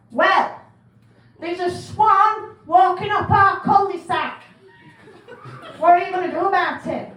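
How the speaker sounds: loud, high complaining caller; outraged resident reporting a swan; indignant caller. Retold or imitated. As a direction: caller moaning about a swan in the cul-de-sac.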